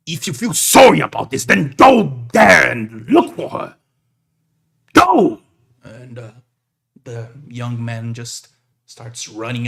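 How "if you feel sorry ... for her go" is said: loud order, the final Go shouted high; stern command. Acted. Big man ordering the young man to search, loud. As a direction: send him off with a hard command. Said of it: "hugs him real tight and goes".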